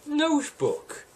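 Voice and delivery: jumps way up on one word; high pitched. Pretend. Comic list of book uses.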